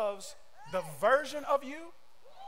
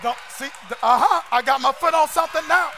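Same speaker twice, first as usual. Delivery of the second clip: louder, shouted sermon cadence; preaching at full force; 3 semitones higher, 8 dB louder than usual. Acted. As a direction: drive the point home, preaching. Preacher builds again to shouting.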